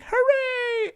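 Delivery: put-on very high squeaky child cheer; a little kid thrilled by a gift; delighted kid. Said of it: "He's like".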